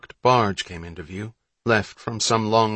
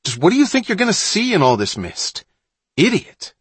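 high-pitched, loud scolding; yelled; 10 semitones higher, 4 dB louder than usual. A narrator's reading. Johnny scolding Mullen on the whalebacks, yelling. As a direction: yell down a foolish companion. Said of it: "Johnny yelled".

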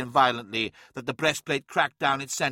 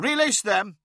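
commanding; 8 semitones higher, 4 dB louder than usual. Acted. Stern command to release prisoners.

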